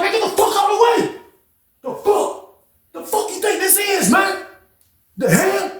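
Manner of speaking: high, strained mock yell without much volume; snapped. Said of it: "He started talking like that".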